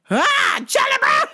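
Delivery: high startled yell of the name; angry shout. Retold or imitated. Mother turns on the pushy customer, shouting his name. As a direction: snap around and yell at him. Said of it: "She spun around like".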